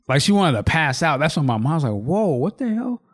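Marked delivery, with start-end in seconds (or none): 1.9-3.0 higher, drawn-out reaction of disbelief; mildly acted shock, voice lifting slowly; retold startled alarm. Father as his child nearly passes out, retold alarm.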